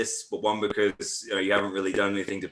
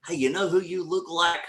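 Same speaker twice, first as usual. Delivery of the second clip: chirpy, higher fan voice asking the question; mock fan; 9 semitones higher than usual. Guest imitating fans who stop him in public, higher eager voice. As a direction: play an eager stranger stopping him. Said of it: "I'll get people".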